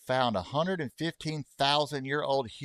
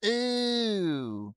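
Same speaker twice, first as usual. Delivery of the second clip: high, drawn-out ooh; long distasteful "ooh" held high; disgusted ooh; 10 semitones higher than usual. Real, not acted. Streamer reacting to Oregon, drawn-out high ooh of distaste.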